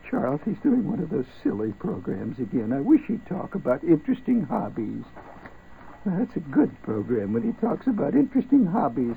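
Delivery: a lightly voiced fussy listener whining about the show; mock listener complaint. Host mocks a listener who complains about his silly programs, hammed-up whiny voice.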